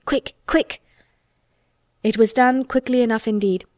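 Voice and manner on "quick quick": higher-pitched urgent hurrying; eager, impatient little bursts; excited. A narrator's reading. Colin hurrying the gardener off, excitedly. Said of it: "digging excitedly".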